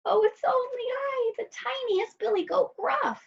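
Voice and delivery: high, tiny goat voice; very small voice. A narrator's reading. Littlest goat answering the troll, very small high voice. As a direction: squeak it as the littlest billy goat. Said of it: "in his very small voice".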